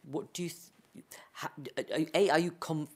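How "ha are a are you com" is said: normal talking voice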